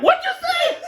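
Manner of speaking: high, loud mock voice with a laugh; laughing high-pitched imitation; mocking quote. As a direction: squeak it out like a startled fool.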